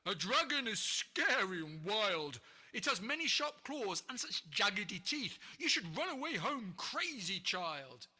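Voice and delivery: loud ogre voice shaken by fear; scared ogre. Acted. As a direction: warn the boy off in horror. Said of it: "yelped the ogre in horror and fear".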